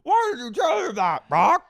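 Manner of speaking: very high, loud mock shriek; mock outraged shriek. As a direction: screech it at him in exaggerated outrage. Retelling Sheena screaming at Brock.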